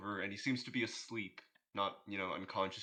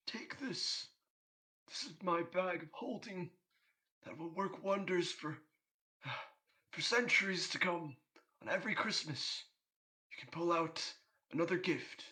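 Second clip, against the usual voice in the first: higher, gentle, grandfatherly Santa voice; kindly Santa; 8 semitones higher than usual. Acted. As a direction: bestow the gift like a benevolent Santa.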